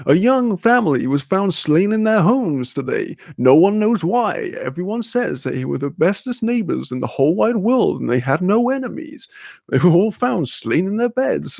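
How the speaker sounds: lightly hammed-up TV news read; mock news anchor. Mocking Florida TV news, a hammed-up anchor read. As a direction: read it like a dramatic local newscaster.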